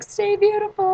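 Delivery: very high fangirl call; calling out flirtatiously. Retold or imitated. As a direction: holler it as a parting compliment. Calling out to blushing server.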